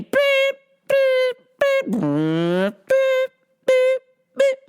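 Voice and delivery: wheelchair beep. Retold or imitated. Imitating wheelchair beeps again.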